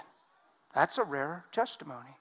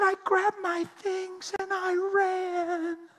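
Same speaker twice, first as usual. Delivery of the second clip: much higher, very slow, tearful; fleeing woman; 4 dB louder, much slower than usual. Retold or imitated. Woman recounting her escape to the preacher, high and slow. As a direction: stretch it out like she's still shaken. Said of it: "She said".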